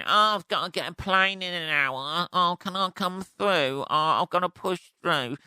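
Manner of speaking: mock-pleading queue jumper; whiny queue jumper. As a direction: imitate a pushy traveller begging to get through.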